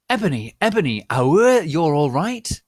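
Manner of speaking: high, loud, anxious calling; worried. Acted. Draco calling out worriedly to fallen Ebony. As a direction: call out to her in alarm. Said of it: "asked Draco in a worried voice".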